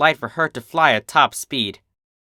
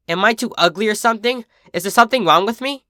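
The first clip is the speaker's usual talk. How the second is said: higher, fast worried plea; anxious pleading; 9 semitones higher, 3 dB louder, faster than usual. A narrator's reading. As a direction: rush out the fear. Filly pleading insecurely.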